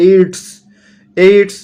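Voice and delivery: "এইডস" loud and high, bringing out the ড sound; stressed D sound. Shown as a demonstration. Teacher shows students the D in "এইডস", pronounced loud and emphatic. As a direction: punch out "এইডস" twice, with an audible D before the S. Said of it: "ড এর মতো উচ্চারণ আসছে".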